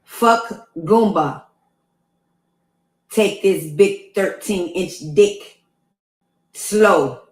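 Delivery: slow, lightly put-on Jamaican accent. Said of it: "This is him in the Jamaican voice".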